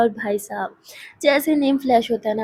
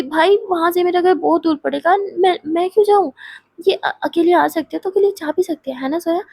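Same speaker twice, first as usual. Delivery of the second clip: higher, louder complaining friend; a pushy friend protesting, higher and louder; exasperated protest; 6 semitones higher, 4 dB louder than usual. Acted. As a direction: whine that it's too far. Mahi argues she won't go.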